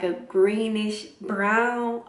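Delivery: ordinary delivery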